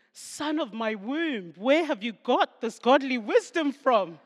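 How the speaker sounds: loud, declaimed mock-biblical wonder; mock-grand amazement. Mother astonished by her young son's wisdom, mock-grand and loud.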